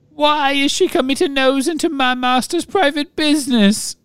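high squeaky sobbing elf; house-elf voice. A narrator's reading. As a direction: squeak it out, tearful. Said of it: "sobbed Winky".

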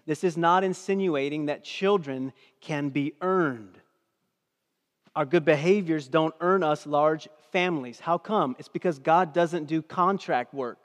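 loud, driving sermon delivery; preaching. Real, not acted. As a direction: preach it hard to the congregation. Preaching at full force.

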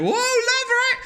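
very high, whooping holler; mock catcall. Man driving past a woman, mock catcall. Imitating catcall from a passing car.

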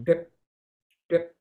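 "Drip, drip" short and separated by gaps; dripping articulation. Shown as a demonstration. Teacher imitating a leaky faucet for short notes, "drip" detached. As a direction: let each "drip" fall like a faucet drop. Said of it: "or a drip from a faucet".

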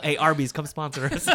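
loud laughing plea. Real, not acted. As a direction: shout it through laughter.

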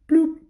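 high, loud popping bloop; cartoon bloop. Telling the kids how the unicorn dropped, a high loud bloop. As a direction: pop it out like a cartoon sound effect.

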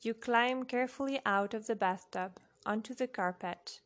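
usual speaking voice

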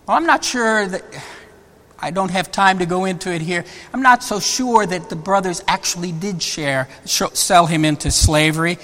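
ordinary talk

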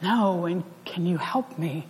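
quiet pleading no. Shown as a demonstration. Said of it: "different kinds of no".

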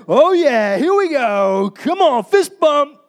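loud, whooping victory entrance; pumped up, big drawn-out cheers; excited celebration. Acted. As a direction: burst in celebrating with the whole office. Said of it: "tell you how I walked in the office".

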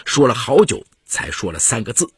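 ordinary delivery

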